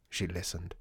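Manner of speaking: usual speaking voice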